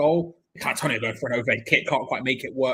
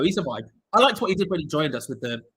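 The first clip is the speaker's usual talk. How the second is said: ordinary talk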